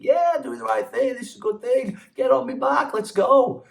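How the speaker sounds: high, hammed-up eager voice; mock eager voice. Mocking a parent's rash decision, high and hammed-up. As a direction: parody someone rushing into a bad choice.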